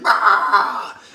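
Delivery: high loud Wookiee yell with a laugh; Wookiee roar. Retold or imitated. Chewie roaring after the stabbing, loud laughing yell. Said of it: "And Chewie's like".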